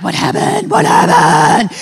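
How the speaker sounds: loud, eager whispered-then-drawn What happened; nosy cry. Retold or imitated. Chapter members demanding to know, loud and drawn out. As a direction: crowd demanding the gossip, drawn out. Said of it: "everyone's like".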